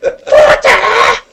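very high, slow, near-crying shriek; shrieking Harry Potter impression. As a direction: wail the name like a hysterical fan. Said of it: "they always go".

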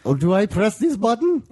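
high-pitched, clueless questioning voice; mock confused stranger. Mimicking the passerby asked to take a picture, mock confused. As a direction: imitate a baffled helper, pitched high.

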